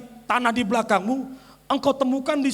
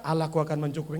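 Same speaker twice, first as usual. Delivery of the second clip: much lower and softer, limp; weak mumble; 10 semitones lower, 9 dB quieter, much faster than usual. Shown as a demonstration. Preacher mocks a weak prayer, limp mumble. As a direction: barely say it. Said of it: "nggak hanya sekedar bilang".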